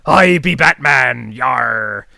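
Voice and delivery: slow, loud growling pirate. Pretend. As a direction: play it as a hammy pirate. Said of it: "Is he gonna sound like a pirate?".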